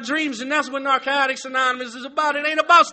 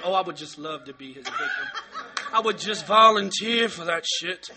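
much lower, laughing mock swoon; 8 semitones lower, 5 dB quieter than usual. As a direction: purr it like a giddy admirer. Mocking eager volunteer.

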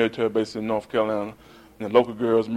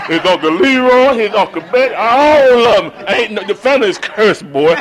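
higher, louder mock grandma scolding; 8 semitones higher, 8 dB louder than usual. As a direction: play the grandma running down the family. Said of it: "she talked just like that".

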